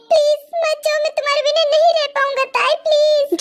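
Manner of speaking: high, tearful begging; desperate plea. Acted. Begging the bride not to leave, desperate.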